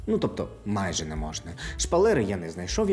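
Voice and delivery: ordinary talk, nothing marked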